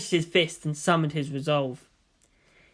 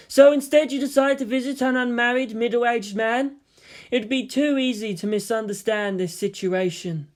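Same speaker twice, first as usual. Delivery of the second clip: put-on teasing girl voice; 6 semitones higher than usual. The figment girl teasing him about visiting a man, higher and teasing. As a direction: tease him with mock suspicion.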